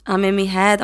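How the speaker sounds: ordinary talk, nothing marked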